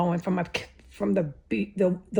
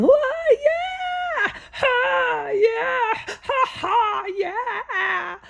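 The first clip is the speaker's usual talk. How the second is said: shrill-free party yells, pitched way up; imitated hollering; much slower than usual. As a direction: mimic people whooping in the backyard. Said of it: "I can hear them hollering".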